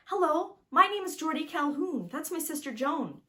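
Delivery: put-on high, bright little boy voice; a seven-year-old boy introducing himself eagerly; young boy voice. A narrator's reading. Young boy asking a stranger for help, high little boy voice.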